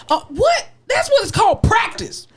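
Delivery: very high-pitched, exasperated disbelief; incredulous. Real, not acted. Host on singers asking to start over, incredulous. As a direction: squeal it out, appalled at the excuse. Mock outrage at singers needing restarts.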